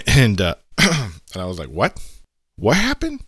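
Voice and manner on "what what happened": a sudden high, startled "What happened?"; retold shock. Hearing a soldier was mauled by a monkey, shocked. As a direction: jump up in pitch with disbelief. Narrator reacts to shocking news.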